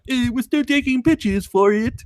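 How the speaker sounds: mock French accent; lightly put-on French accent. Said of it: "That's my French accent".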